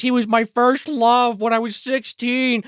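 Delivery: high, slow melodramatic confession; mock lovelorn girlfriend. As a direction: gush helplessly about a first love, hammed up. Girlfriend's confession of love, parodied.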